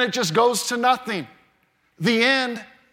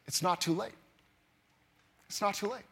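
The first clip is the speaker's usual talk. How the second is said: soft reassurance; 12 dB quieter, faster than usual. Acted. Preacher repeats hope softly.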